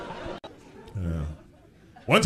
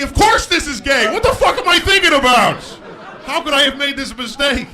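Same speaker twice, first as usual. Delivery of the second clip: high, loud mock panic; horrified realization; 12 semitones higher, 7 dB louder than usual. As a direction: shout it in sudden comic dismay. Acts sudden horrified realization.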